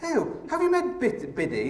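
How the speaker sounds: high-pitched imitation of a radio presenter; Libby Purvis. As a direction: put on her voice, high at first, then dropping. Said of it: "Libby Purvis said to me".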